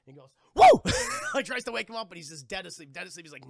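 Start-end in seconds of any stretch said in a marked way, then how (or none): 0.6-0.6 sudden high, loud whoop; mock whoop. Streamer imitates waking the drunk blacksmith, sudden comic whoop. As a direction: burst out to startle a sleeper. Imitates poking a sleeping man.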